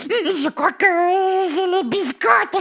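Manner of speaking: high, slow, drawn-out creature voice; scared little dog. Acted. Scared dog confessing to the host, high slow voice. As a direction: draw it out high and timidly.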